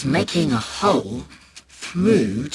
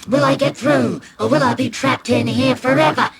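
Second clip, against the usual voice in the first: higher, louder adventurer voice; dramatic explorer; 9 semitones higher, 5 dB louder than usual. Pretend. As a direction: play the brave explorer in peril. Boy imagines dangerous cave adventure.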